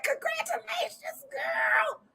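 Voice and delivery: quoted. Retold or imitated. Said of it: "She said".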